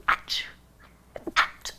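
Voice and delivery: two stifled little sneezes; smaller sneezes. Real, not acted. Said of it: "I'm sorry to the ears ... because I know that sucked".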